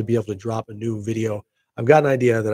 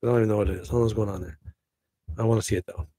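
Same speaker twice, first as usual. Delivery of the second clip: normal talking voice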